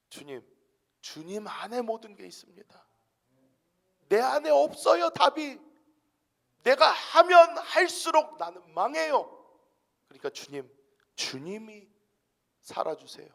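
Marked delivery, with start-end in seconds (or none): 4.1-9.3 high, loud preacher's cry; fiery sermon peak; preaching at full force. Acted. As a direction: lift the congregation with a ringing shout.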